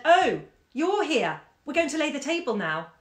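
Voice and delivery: put-on high, loud child's exclamation, then announcing; child bursting in. A narrator's reading. Child bursts in on mother before laying table, excited child. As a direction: burst in with a high-pitched girl's voice.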